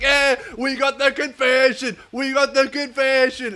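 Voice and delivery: high, stretched-out gloating cheer; celebrating cops. Acted. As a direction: crow it like a victory chant. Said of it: "they all just start celebrating".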